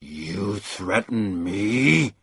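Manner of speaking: slow, cold offended menace; a hint of danger rising; bristling deep voice. A narrator's reading. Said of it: "The deep voice bristled".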